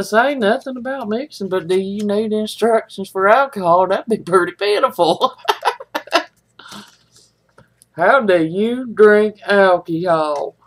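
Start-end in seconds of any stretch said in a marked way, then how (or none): 5.4-6.2 a short high chuckle; small high laugh, falling. Real, not acted. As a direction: chuckle at your own joke. Laughs at own joke.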